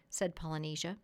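usual speaking voice

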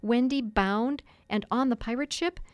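plain reading voice

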